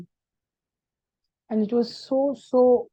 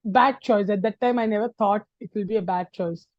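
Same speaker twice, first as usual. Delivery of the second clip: ordinary delivery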